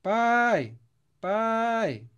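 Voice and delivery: Pai called out high and loud, stress on the A; calling out. Shown as a demonstration. Teacher calling the word pai aloud to show the strong A. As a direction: call the word pai like a shout. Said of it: "Tô chamando a palavra pai".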